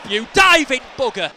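loud, high accusation; indignant football-fan outburst; angry shout. Real, not acted. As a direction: yell abuse at a cheating player. Abusing a diving player.

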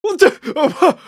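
shrill, high what the, loud; stunned. Real, not acted. Host stunned by Anakin's reaction, loud surprised blurt. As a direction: caught off guard, blurting it out.

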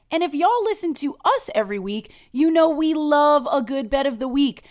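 loud, upbeat sponsor pitch; ad read. Acted. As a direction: sell it like a radio ad. Cohost reads sponsor ad.

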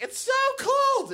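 high, drawn-out shriek; loud drunk girl. Retold or imitated. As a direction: shriek it like a drunk tourist complaining. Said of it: "they are so loud".

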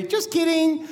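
smiling prankster. Pretend. Imagined pilot revealing a prank, smiling and teasing. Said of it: "he's gonna smile and say".

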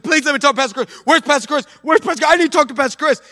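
high, pleading mock hysteria; woman begging the assistant to see the pastor; hysterical pleading. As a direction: beg desperately for the pastor. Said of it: "started getting real hysterical".

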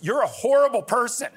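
high, loud mock outrage; child's outraged scolding. Daughter yelling at a TV character, imitated outrage. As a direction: imitate an indignant kid yelling at the TV. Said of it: "she's talking to the screen".

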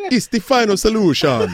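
high mock Jamaican accent; laughing through a cod Jamaican accent; Hitler in Jamaican accent. Dictator parody for the co-host, high Jamaican accent. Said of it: "in a Jamaican accent".

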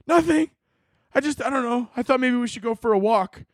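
high, meek little voice; meek excuse. Acted. Beaten joy suggesting a walk, meek excuse.

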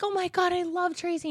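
high-pitched mock fan adoration; gushing fan. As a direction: imitate a fan squealing with love. Voices fans gushing to her mother.

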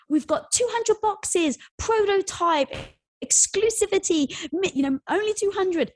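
mock announcing an exclusive prototype release; hyped sales pitch. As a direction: oversell it like a limited drop ad. Retold Facebook sales blast.

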